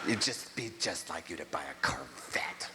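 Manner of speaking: low, soft, sneering aunt; aunt's disgusted voice. Retold or imitated. Aunt Rose scolding her nephew over the Corvette, low and soft. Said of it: "she was so pissed".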